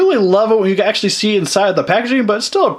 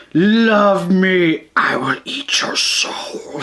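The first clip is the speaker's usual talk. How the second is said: slow whispered creature voice; creepy creature voice; much slower than usual. Retold or imitated. Voicing creepy Dorats.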